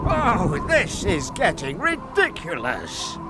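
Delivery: exasperated cry. A narrator's reading. Magic fish losing patience with Frederick, exasperated cry. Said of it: "cried the fish".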